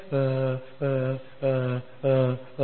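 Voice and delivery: low, level string of hesitations; mimicked reluctant stalling. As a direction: imitate someone hemming to avoid saying yes.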